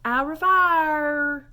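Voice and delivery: "au revoir" loud, high and very slow; rolled practice call. Shown as a demonstration. Teacher cheering on practice, loud stretched call. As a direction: belt "au revoir" with a long drawled ending. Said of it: "Yep, practice it".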